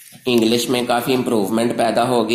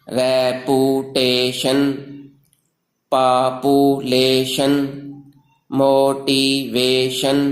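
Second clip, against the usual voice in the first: very slow, four evenly stretched beats per word; syllable by syllable; much slower than usual. Shown as a demonstration. Teacher shows learners four-syllable rhyming words, slow syllable by syllable. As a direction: sound out each word in four separate syllables. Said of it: "जो ज़ुबान की चार हरकतों से".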